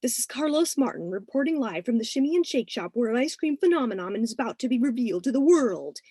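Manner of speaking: lightly voiced TV news anchor; booming baritone. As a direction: play the teen ham news reporter into a spoon. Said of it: "he pronounced in a booming baritone".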